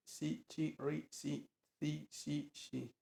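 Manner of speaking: "si, chi, ri, si, di, si" in a Chinese-like voice, soft; Chinese syllables. Shown as a demonstration. Narrator demonstrating pinyin syllables to readers, soft Chinese sounds. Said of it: "excepto cuando va precedida de".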